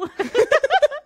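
high loud laugh at her own joke; burst of laughter. Real, not acted. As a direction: crack up at the joke. Joke about not needing a lighter.

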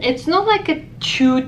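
ordinary talk, nothing marked

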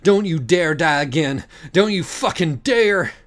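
high, loud, tearful pleading; desperate pleading. A narrator's reading. Pam weeping over her friend, high desperate plea. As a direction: beg her not to die, breaking.

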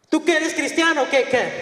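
acted high-voiced interrogation; high-pitched questioning. Officer's question acted a second time.

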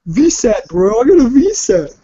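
loud, high-pitched trash-talk yell; hammed-up shouted boast; mock gamer bragging.

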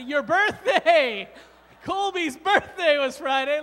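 loud, slow teasing shout; playful shout. Real, not acted. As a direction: call it out like a birthday cheer. Teasing a child about birthday.

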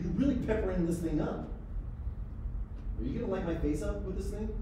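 ordinary delivery

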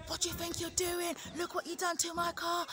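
high-pitched mock rant; put-on road-rage voice, pitched right up; imagined angry driver. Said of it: "whether they're gonna be like".

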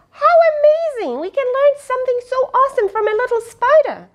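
fast, high, gushing excitement; thrilled amazement. Acted. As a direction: burst with wonder for the child. Excited reading to child.

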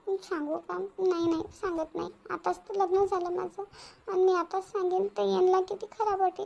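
quietly reasoning with herself about hiding the trouble; troubled inner monologue. Acted. Inner thoughts, hidden sorrow.

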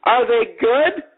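high, very slow mock curiosity; dripping with sarcasm. Woman in car needling the queue, imitated dripping sarcasm. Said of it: "Just dripping with sarcasm".

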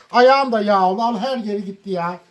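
ordinary talk, nothing marked

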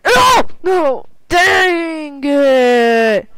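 high, loud dismayed shout; frustrated shout. Real, not acted. Missing the jump in the game, frustrated shout.

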